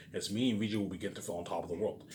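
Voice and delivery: usual speaking voice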